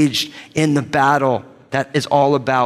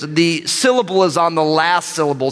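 normal talking voice

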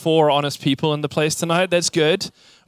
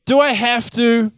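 put-on higher, slow whiny kid; whiny child; 6 semitones higher, 4 dB louder, slower than usual. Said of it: "and you're like".

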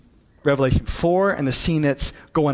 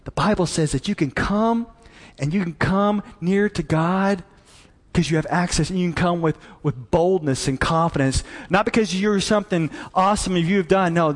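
ordinary delivery

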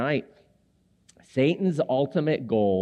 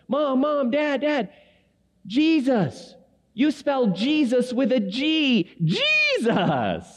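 put-on high, excited child insisting; little daughter's voice; 12 semitones higher, much slower than usual. Daughter in the van correcting her parents, high childlike voice. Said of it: "she goes".

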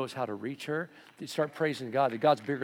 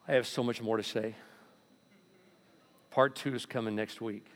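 usual speaking voice

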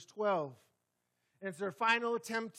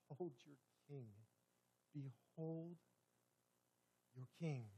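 soft, hushed proclamation; solemn presentation; 4 semitones lower, 10 dB quieter than usual. Retold or imitated. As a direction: hush it, a grave unveiling. Pilate presenting Jesus, solemn.

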